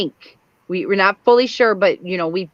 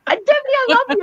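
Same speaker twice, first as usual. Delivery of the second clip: squealing much higher with delight; excited. Real, not acted. Host thrilled by Debbie's theory, much higher squeal. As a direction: gush over the theory.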